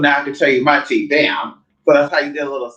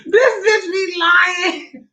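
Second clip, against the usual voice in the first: high, slow laughing squeal; laughing shriek; 11 semitones higher, much slower than usual. Real, not acted. Teasing friend as liar.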